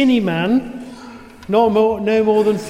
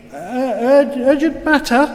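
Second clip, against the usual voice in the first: halting, higher stammer; 5 semitones higher than usual. A narrator's reading. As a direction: trip over the words in shock. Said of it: "managed to stammer out the words".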